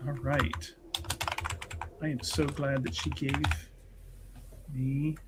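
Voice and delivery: normal talking voice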